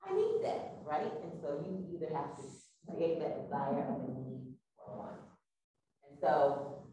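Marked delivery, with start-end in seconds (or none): 0.1-0.8 hushed high "oh" building to an eager exclamation; sudden realization. Acted. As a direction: play a customer suddenly realizing a need. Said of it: "You hear something like".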